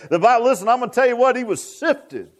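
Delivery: high-pitched, driving sermon delivery; fervent preaching. Real, not acted. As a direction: preach it with rising fervor.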